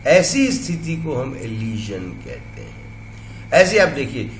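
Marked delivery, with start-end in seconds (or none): none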